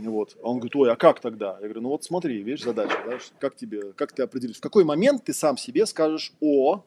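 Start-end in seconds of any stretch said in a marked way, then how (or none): none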